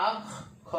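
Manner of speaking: আখ, a brief break, then খ; doubled kh demo. Shown as a demonstration. Teacher shows learners how tashdid is pronounced, doubled-kh demo. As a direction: sound the kh twice in আখ খ. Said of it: "এর উচ্চারণটা হবে".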